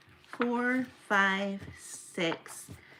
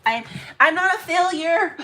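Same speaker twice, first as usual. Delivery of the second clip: loud and higher, then a giggle; laughing; 9 semitones higher, 8 dB louder than usual. Real, not acted. Order done, she jokes she's no failure and laughs. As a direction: defend yourself jokingly, then laugh. Jokey self-defence.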